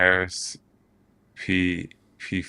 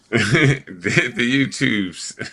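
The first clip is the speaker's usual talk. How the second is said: louder, higher, slow mock old man; 6 semitones higher, 5 dB louder, much slower than usual. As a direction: drag it out like a grandpa. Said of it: "I sound like an old man".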